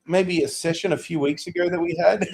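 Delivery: real laughter running through the words; high, laughing reply to a co-host. Real, not acted. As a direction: giggle through the line as you remember. Laughing at the shared memory.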